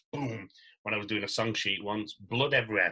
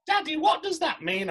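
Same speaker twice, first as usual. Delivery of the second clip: put-on much higher, innocent child's question; little girl's voice; 4 dB louder than usual. Daughter asking her dad about the award, high child voice. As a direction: ask it like a curious small daughter. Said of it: "one of my girls came and said".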